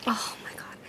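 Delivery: breathy whispered groan; whispering. Real, not acted. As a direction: whisper it to yourself in dismay. Dreading rubber bands, under breath.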